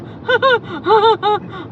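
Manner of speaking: high, loud imitated giddy laugh. Imitating a man stuck on the roundabout, giddy laughing. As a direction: imitate someone helplessly giggling. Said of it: "like, giddy laughing".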